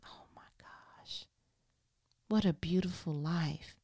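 breathless whisper of wonder; awed whisper. Shown as a demonstration. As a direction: whisper it like an exhale of relief. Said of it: "the rest just reads like".

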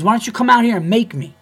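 loud, high retold dare; dead-serious challenge. Club employee daring the comedian outside, loud dead-serious challenge. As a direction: mimic someone itching for a fight. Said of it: "Dead serious".

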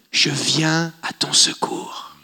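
slow gentle whisper; whispered reply. Retold or imitated. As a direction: whisper it softly, reassuring. Voicing God's reply softly.

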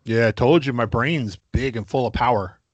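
ordinary talk

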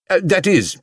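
high, flustered self-correction; hasty. A narrator's reading. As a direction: backtrack hurriedly with an "uh". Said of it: "he added hastily".

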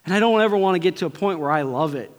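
normal talking voice

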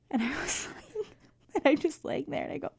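ordinary talk

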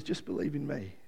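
soft, low, gentle Jesus plea; tender, quiet voice of Jesus; tender invitation. Retold or imitated. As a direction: invite them in softly. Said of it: "he comes to us and he says".

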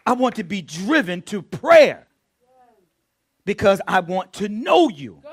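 loud, emphatic plea to God; fervent prayer. Acted. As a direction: preach the prayer out, swelling on key words. Fervent prayer, then repeated low.

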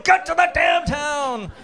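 high, loud, exasperated shout at the radio; frustrated yell. Retold or imitated. Kid yelling at the radio to hurry up, a laughing shout. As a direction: yell at the slow announcer, laughing. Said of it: "and you're like".

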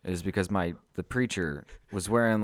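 ordinary delivery

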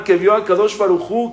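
ordinary talk

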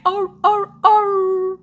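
high, loud answering howls; wolf howl. Retold or imitated. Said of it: "Was that a wolf howl?".

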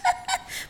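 short high disbelieving laugh; incredulous laugh. Acted. Maid laughing off being kidnapped, high and disbelieving. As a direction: scoff at the very idea.

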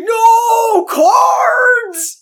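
high shrieking wail; mock cry of pain. Villain hit by flying cards, shrieking mock wail. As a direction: howl like cards hit your face.

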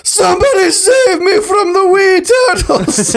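high, slow hammed-up Scottish wail; Scottish cry for help. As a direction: mock-desperate Scottish shout. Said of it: "And he goes".

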